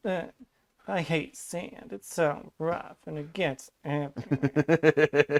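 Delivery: slow, mock petulant whine; whiny baby. Imitating Anakin complaining about sand, whiny baby voice. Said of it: "I want you to sound like a whiny baby".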